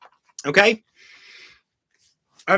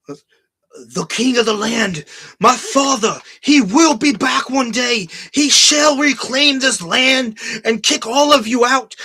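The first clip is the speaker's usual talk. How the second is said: slow, high, grandiose mock-heroic voice; mock epic king speech; 6 semitones higher, 6 dB louder, slower than usual. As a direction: ham up a pompous royal proclamation. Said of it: "telling Robert Pattinson".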